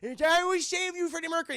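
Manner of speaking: high, loud mock rescue yell; heroic cry. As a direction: play a panicked would-be hero, hammed up. Acts out hero's rescue cry.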